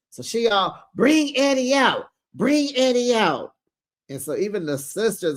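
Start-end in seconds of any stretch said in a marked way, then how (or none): 0.9-3.5 high, put-on repeated holler; mocking call. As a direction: call it out high like a taunting chant. Acted mother chanting at Eddie.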